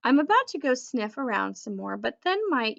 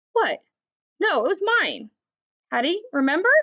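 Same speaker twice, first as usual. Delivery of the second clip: surprised protest; 6 semitones higher, 5 dB louder than usual. Acted.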